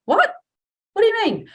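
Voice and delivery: confused. Retold or imitated. Confused concert-goer hit with maths.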